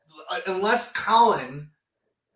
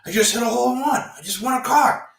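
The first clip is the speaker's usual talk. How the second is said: louder, excited retelling; mock thrilled phone call; imitating excited friend; 5 semitones higher, 5 dB louder than usual. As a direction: friend announcing his big win, excited. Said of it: "he's like".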